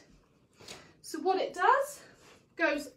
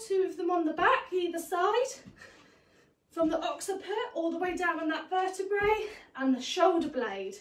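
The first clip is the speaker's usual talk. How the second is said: ordinary delivery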